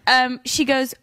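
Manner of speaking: ordinary talk, nothing marked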